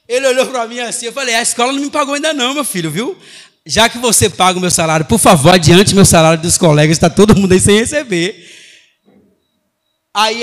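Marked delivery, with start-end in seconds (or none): 1.3-8.4 loud, indignant teacher's comeback; retold protest, building much louder; loud retort. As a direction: answer the pupil back at full volume. Teacher answering pupil back, retold.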